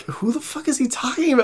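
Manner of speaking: recalling his own confusion; confused. Retold or imitated. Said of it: "I was like".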